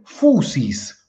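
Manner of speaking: fusis slowly with a plain Spanish u; wrong pronunciation. Retold or imitated. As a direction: fusis as others mispronounce it. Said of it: "Muchos le dicen".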